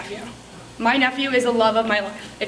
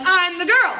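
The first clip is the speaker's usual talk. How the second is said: raised pitch, confident self-announcement; pumped-up boast; 4 semitones higher, slower than usual. Acted. Consultant presenting herself to guests who don't know her, pumped up. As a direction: announce yourself proudly to the room. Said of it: "I'm still pumped up".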